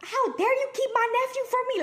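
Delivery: high-pitched mock outrage; going crazy. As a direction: reenact an indignant, excited protest. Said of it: "I'm going crazy".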